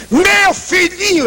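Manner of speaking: put-on mother crying out for her little boy; indignant outcry. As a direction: wail it out in protest. Mother cries out defending son.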